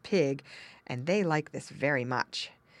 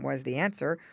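ordinary delivery, nothing marked

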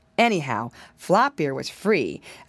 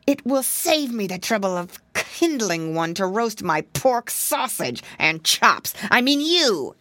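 a fox revealing he means to eat the piglet; sly gloating fox reveal; sly fox exclaiming; 3 semitones higher than usual. Acted. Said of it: "exclaimed the voice".

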